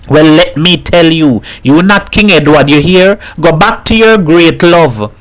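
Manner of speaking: a mother mocking her dead husband to a visitor; lightly voiced Trinidadian mother joking.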